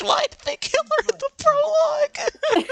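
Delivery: high laughing wail; laughing cry. Real, not acted. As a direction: shriek it through laughter. Laughing outburst over dead character.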